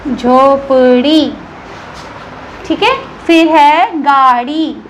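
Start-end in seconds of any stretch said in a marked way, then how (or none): 0.1-1.3 झोपड़ी drawn out very slowly, flapped ड़; slow demo word. Shown as a demonstration. Teacher repeating the word for pupils: slow झोपड़ी. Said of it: "और जैसे है झोपड़ी".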